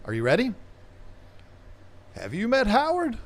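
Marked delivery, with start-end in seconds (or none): 2.1-3.2 quoted movie line. Retold or imitated. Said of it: "I wish I could say it in his voice".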